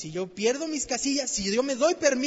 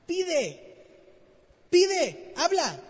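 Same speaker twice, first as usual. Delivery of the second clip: urgent call; 7 semitones higher, 4 dB louder, much slower than usual. Real, not acted. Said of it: "urging the congregation to ask".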